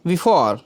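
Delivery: before slowly, with a long falling "aw"; correct pronunciation. Shown as a demonstration. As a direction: stretch the last vowel of before, "bi-fawr". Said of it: "शुद्ध उच्चारण का before होता है". (before का आख़िरी "ऑ" खींचकर बोलें)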